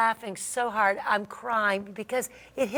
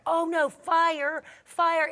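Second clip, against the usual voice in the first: higher, drawn-out alarmed realization; retold panic; 8 semitones higher, much slower than usual. Woman recalling a pan on the stove, retold panic. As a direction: re-enact sudden panic, stretching the word. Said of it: "this panic hits me".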